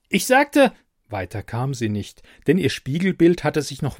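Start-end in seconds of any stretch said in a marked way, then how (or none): 0.1-0.7 high, loud furious start; angry shout. Acted. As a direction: snap at the computer, much higher. Brigitte furious at computer.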